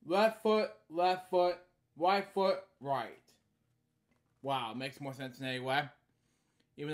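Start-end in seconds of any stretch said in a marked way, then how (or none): 0.1-3.1 lively sing-song read-aloud; storybook reading. Acted. Reading a bedtime story aloud, lively. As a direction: read each line brightly to a child.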